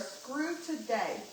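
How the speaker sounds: slow, soft and done with the day; fed-up dismissal. Retold or imitated. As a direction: let it drop, resigned. Fed-up sign-off of retold call.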